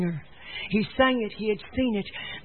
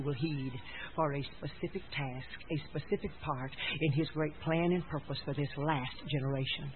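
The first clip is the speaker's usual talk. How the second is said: low, soft, solemn sermon; quiet, measured pulpit intimacy; preach; 6 semitones lower, 12 dB quieter than usual. Real, not acted. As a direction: bring it right down, earnest and hushed.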